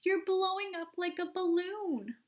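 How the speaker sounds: high screech, still horrified. A narrator's reading. Mother screeching at her ballooning daughter. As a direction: keep shrieking in alarm. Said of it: "screeched Missus Beauregard".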